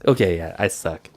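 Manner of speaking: normal talking voice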